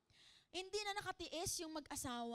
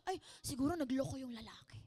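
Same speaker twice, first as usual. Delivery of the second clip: mock gossip; 3 semitones lower, faster than usual. Mocking judgmental gossip.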